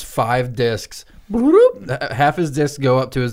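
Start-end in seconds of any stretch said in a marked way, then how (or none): 1.3-2.1 high, loud comic bloop; slipping-disc sound effect. Retold or imitated. Mimicking slipped discs, high comic sound effect. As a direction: pop out a cartoon bloop.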